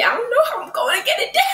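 high, fast panicked shout; half-crying in real fear. Real, not acted. Scared before cinnamon challenge.

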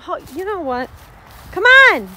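ordinary talk, nothing marked

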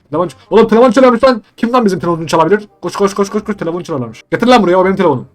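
fast, high, loud panicked rush; frantic hurry; excited panic. Real, not acted. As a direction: scramble for the ringing phone. Phone rings, he panics.

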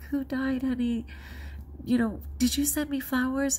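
mock anxious. Retold anxious call to husband.

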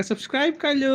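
put-on high, lisping baby-talk plea; toddler voice. Speaks for toddler asking viewers. (ਤੋਤਲੀ ਬੱਚੇ ਵਾਲੀ ਆਵਾਜ਼)

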